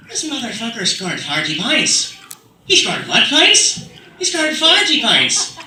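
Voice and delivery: put-on heated, drawn-out Irish accent; loud, high Irish-accented banter. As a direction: argue about sports in a broad Irish accent. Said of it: "Then if you're in Ireland".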